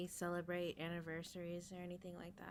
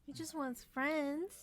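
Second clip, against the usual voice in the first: high, drawn-out sympathetic coo; pitying coo; 8 semitones higher, 5 dB louder, slower than usual. Real, not acted. Friend pities the big dog left at the fence, high slow coo. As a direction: coo it slowly, pitched up, full of pity.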